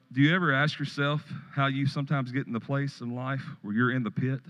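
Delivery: ordinary talk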